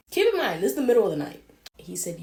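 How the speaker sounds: plain reading voice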